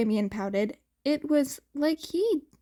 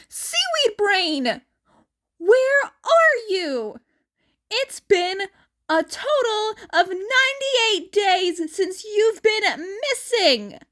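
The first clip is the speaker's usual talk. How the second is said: calling out desperately; 8 semitones higher, 5 dB louder than usual. Acted. Annabeth searching for missing boyfriend, high desperate calling. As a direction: shout it across the camp, searching.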